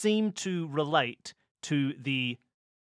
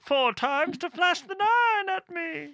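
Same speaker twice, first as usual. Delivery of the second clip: through a tube; 11 semitones higher, 3 dB louder than usual. Pretend. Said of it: "This is my through a tube voice".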